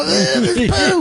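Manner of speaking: disgusted "ugh" played for laughs; comically freaking out over poo; mock freak-out. Dad in the delivery room, mock freak-out. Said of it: "freak the fuck out and go".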